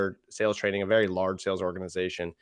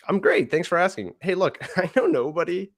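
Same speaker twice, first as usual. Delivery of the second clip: higher and louder, a laugh slipping in; friendly, upbeat; 9 semitones higher, 3 dB louder than usual. Shown as a demonstration. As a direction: keep it light and disarming. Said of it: "It's friendly. It's warm".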